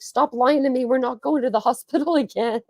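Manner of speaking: high, lightly voiced annoyed husband, laughing; dad telling mom he won't go back, mild mock exasperation; mock exasperated dad. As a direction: play the groggy fed-up dad, half-laughing. Dad exasperated with wife at night.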